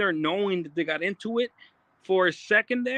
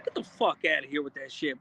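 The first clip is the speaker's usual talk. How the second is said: ordinary talk, nothing marked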